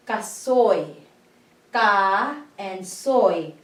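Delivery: Kasoy with ka and soy pulled apart slowly, oy gliding; Ka and soy drawn out, then joined; slow syllable split. Shown as a demonstration. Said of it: "This is a diphthong here".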